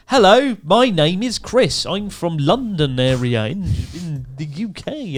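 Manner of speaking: high, very slow, over-enunciated posh greeting; posh careful voice. Retold or imitated. Newcomer greeting Japanese teachers in a slow, high, posh voice. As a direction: spell out every word like a royal introducing himself. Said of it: "have to change my voice to sound really, like, royal pronunciation".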